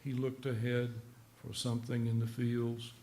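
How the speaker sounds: slow, measured poem recital; soft, deliberate verse reading. Acted. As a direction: read the poem evenly, pausing at each phrase. Reads his poem aloud.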